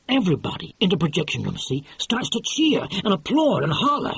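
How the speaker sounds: lightly voiced excitable producer; brash film producer. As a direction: sell it like a fast-talking movie boss. Producer retelling the rushes.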